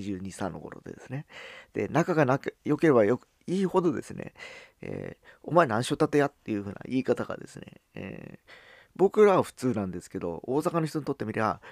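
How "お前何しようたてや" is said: higher, louder Hakata-dialect challenge, rising on 前; rough Hakata question that sounds threatening to outsiders. Retold or imitated. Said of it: "威嚇してるふうに聞こえると".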